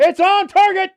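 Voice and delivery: very high, loud shout; excited match call. Real, not acted. Commentator follows the ball on target, excited high shout. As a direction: yell it as the shot flies in. Calling a shot live.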